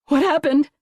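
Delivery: high, loud frightened cry; desperate cry. A narrator's reading. Mother crying out to the leaving agent, panicked. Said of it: "Margot cried".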